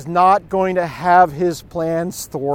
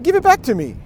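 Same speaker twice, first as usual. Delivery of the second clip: higher, insistent demand; retold demand; 8 semitones higher, faster than usual. Friend demanding his pen from the thief, insistent and high. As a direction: order the thief to hand it back.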